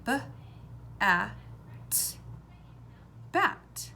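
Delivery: B, a, t separated, then blended into bat; sounding out. Shown as a demonstration. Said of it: "put all the sounds together".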